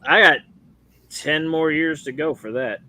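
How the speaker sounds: usual speaking voice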